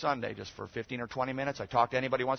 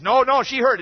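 high, loud mock protest; insistent mother; 13 semitones higher, 16 dB louder than usual. Upset mother overruling the pastor, hammed-up insistence. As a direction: imitate someone who refuses to be contradicted. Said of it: "she was so insistent".

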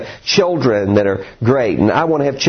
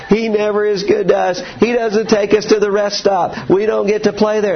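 mock whiny kids; 8 semitones higher than usual. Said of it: "they're crying in the back seat".

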